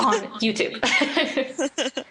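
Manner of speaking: on YouTube, slow and laughing; slowed, giggling fix; laughing correction. Real, not acted. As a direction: correct yourself while laughing. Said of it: "I sound like an old person".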